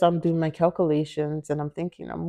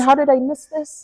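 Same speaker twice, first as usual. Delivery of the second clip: freaking out doctor; 8 semitones higher than usual. Retold or imitated. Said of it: "She was freaking out".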